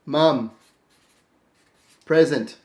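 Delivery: "Mum" then "present" as separate clipped words with long pauses; slow note-taking. Shown as a demonstration. Showing students how to jot a note, slow and terse. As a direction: write it down word by word. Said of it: "This is what we do".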